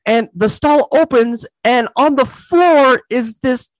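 excited disbelief. Real, not acted. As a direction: wind up the shocking reveal, drawing it out high. Retelling a shocking movie scene.

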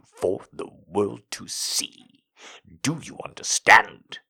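low, soft, commanding male voice; stern dominant man. A narrator's reading. Dominant man's threats.

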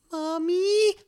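very high, drawn-out little plea; wheedling call. Retold or imitated. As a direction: coax mom like a needy child. Wheedling mother for a favour.

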